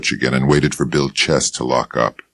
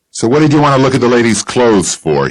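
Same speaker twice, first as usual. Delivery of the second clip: sharp, higher, louder and fast; angry; 9 semitones higher, 5 dB louder, faster than usual. Acted. Bill Chess confronts the detective over the clothes, angrily. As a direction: snap it out, suspicious and protective. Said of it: "he asked in an angry voice".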